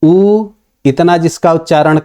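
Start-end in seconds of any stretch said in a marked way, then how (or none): 0.0-0.5 उ held as a long oo; drawn-out long उ as a teaching example; long vowel. Shown as a demonstration. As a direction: stretch उ to two beats. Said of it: "उसको कहेंगे दीर्घ".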